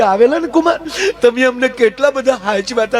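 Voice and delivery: mother-in-law. Retold or imitated.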